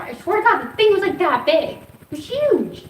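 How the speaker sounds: rising disbelief about the bug's size; excited exaggeration. Real, not acted. Describing the huge bug.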